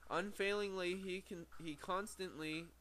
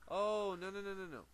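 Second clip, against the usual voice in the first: dismayed oh no; 7 semitones higher, 5 dB louder than usual. Real, not acted. Realises he misread the line.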